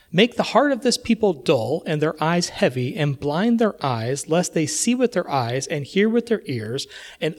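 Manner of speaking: solemn scripture declaimed to the church; scripture reading. Acted. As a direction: proclaim it like God's charge to the prophet. Reading God's words in scripture.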